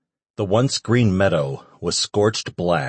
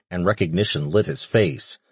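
plain reading voice

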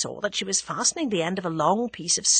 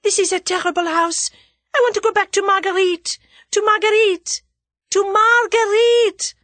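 louder still; 12 semitones higher, 7 dB louder than usual. A narrator's reading. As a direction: cry it down the stairs as a signal. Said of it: "louder still".

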